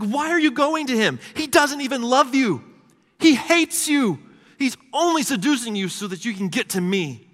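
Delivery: high, loud, impassioned pleading; frustrated pleading. Retold or imitated. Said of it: "You hear God pleading".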